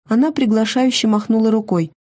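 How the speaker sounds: ordinary delivery, nothing marked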